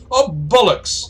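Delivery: high, loud toff's curse; exclaimed dismay. Acted. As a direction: cry out at the dropped monocle. Airship man loses his monocle.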